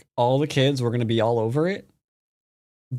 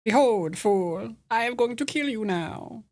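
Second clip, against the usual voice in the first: high, theatrical villain voice; put-on high-pitched villain threat; villain taunt; 8 semitones higher than usual. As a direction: gloat like a grandiose cartoon villain. Acting game villain taunting.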